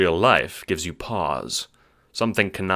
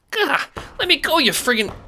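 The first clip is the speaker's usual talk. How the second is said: high squeaky protest, louder; squeaky struggling guard; 5 dB louder than usual. Acted. Pinned little guard squeals at the Seer. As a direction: squeal while struggling to get free.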